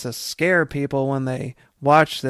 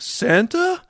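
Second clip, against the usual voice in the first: much higher, rising sitcom-style "Santa?"; joking sitcom Eddie. Retold or imitated. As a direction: play it for the studio audience. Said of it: "that joking sitcom way".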